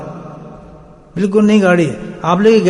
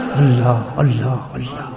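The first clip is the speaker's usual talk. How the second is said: low, soft repeated Allah like a heartbeat; heart chanting Allah; 8 semitones lower, 4 dB quieter, much slower than usual. Retold or imitated. Preacher mimics the heart beating Allah, low soft chant. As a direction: pulse the name quietly, like a beating heart. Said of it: "دل کیا کر رہا تھا؟".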